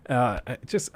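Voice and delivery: sputtering after inhaling wrong; catching a choked breath; choking on breath. Real, not acted. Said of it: "I just inhaled my throat".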